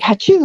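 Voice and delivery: high mock sneeze; fake sneeze.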